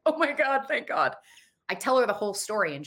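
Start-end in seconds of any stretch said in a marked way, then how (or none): 0.1-1.1 relief. Retold or imitated. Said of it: "And I was like".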